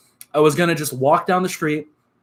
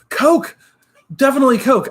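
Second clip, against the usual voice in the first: eager answer; 10 semitones higher, 4 dB louder than usual. Real, not acted. Streamer answers chat's Pepsi or Coke question, high and loud. Answers chat poll emphatically.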